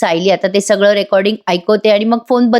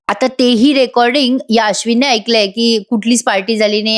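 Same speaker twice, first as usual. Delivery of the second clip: usual speaking voice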